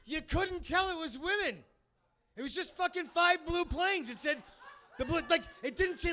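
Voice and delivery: loud, high exasperated rant. Real, not acted. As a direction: vent the outrage near shouting. Ranting about the flyover.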